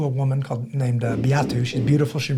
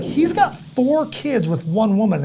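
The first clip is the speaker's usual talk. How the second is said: high, drawn-out mock amazement; astonished; 8 semitones higher, slower than usual. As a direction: imitate a scandalized announcement, slow and high. Imitating astonished people telling others.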